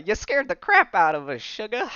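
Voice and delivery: put-on US Southern accent, lifting on sugar. Shown as a demonstration. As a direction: say it like a Southern belle from the comics. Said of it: "it's more of a southern accent".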